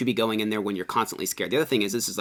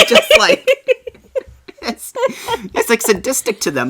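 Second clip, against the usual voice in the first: much higher, louder, words squeezed through laughter; laughing through words; 9 dB louder than usual. Real, not acted. Host still laughing about the smug skeptic, talking through laughter. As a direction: talk while still cracking up.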